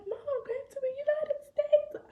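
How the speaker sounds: very high-pitched retold scream of joy; excited scream. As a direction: scream the big news to mom, voice shooting way up. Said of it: "And I screamed".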